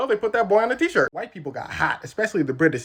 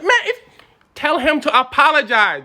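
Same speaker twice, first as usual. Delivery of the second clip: high, loud mock outburst; exasperated demand; 8 semitones higher, 7 dB louder than usual. British demanding an apology, loudly exasperated. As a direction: lose patience, pitched up and loud.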